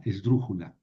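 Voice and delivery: usual speaking voice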